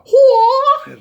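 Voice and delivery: very high, loud martial-arts cry; mock ninja yell. Introducing the ninja zombie, mock martial-arts yell. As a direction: let out a hammed-up ninja battle cry. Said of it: "is the ninja".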